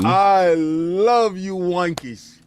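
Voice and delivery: slow, drawn-out fake accent; lightly put-on UK accent; mock British accent. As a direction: croon it slowly in mock British. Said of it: "I don't like you in this accent".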